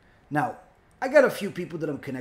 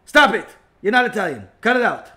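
loud, emphatic orders in short bursts; acted scolding; 4 semitones higher, 8 dB louder than usual. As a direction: shut it down forcefully. Acted listener scolding the rabbi.

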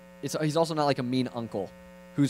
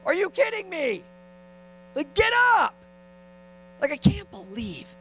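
very high exasperated dad outburst; hammed-up angry dad; mock angry father; 14 semitones higher than usual. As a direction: scold a toddler in high-pitched disbelief. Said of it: "God's not like an angry father saying".

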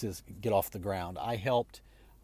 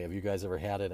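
ordinary delivery

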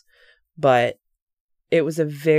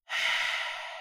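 weary sigh; 9 dB quieter than usual. Retold or imitated. Resigned before admitting she sees.

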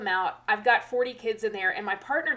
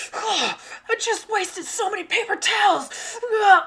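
high, loud mock-tearful wail; hammed-up meltdown ending in a groan; flipping out; 11 semitones higher, 7 dB louder than usual. Teacher imitating Butters over wasted towels, wailing freak-out. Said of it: "flipping out".

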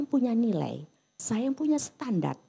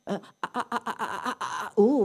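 mock stammer. Shown as a demonstration. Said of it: "kalau jadi utusan ngomong nih".

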